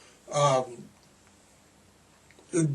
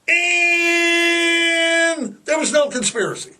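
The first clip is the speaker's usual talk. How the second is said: slow, high mocking official line; mock the official verdict, drawn out; sarcastic drawl; 9 semitones higher, slower than usual. Real, not acted. Telling Andy the commission's finding with heavy sarcasm. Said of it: "can you hear the sarcasm".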